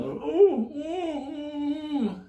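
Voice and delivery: high muffled whimpers; gagged whimpering. Retold or imitated. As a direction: whimper like a tied-up hostage. Tied-up man whimpering.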